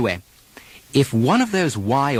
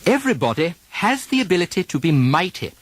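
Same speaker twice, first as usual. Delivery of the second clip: British RP sentence; 5 semitones higher, faster than usual. Shown as a demonstration. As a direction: model the line in British RP. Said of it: "Repeat them after me".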